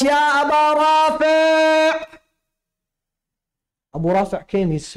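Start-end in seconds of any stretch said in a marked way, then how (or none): 0.0-2.2 high, drawn-out call across the treasury; retold shout. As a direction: call the treasurer's name slowly, sung out. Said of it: "he shouted out".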